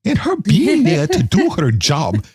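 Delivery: put-on high-pitched hammy German accent; comically poor German-accented therapist; Doctor Ruth. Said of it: "That was the worst Doctor Ruth".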